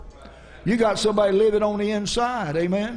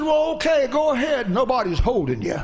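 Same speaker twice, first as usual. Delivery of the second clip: higher, impatient acted comeback; blasting retort; 6 semitones higher than usual. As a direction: imitate a man irritably giving him leave. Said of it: "the guy's kinda, uh, blasting him".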